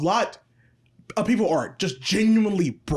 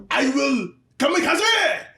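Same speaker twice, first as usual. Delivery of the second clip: mock Japanese accent; 5 semitones higher, slower than usual. Soldier losing the war vows kamikaze, hammed-up Japanese accent. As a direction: put on a slow, higher-pitched Japanese accent. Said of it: "you had to do the accent".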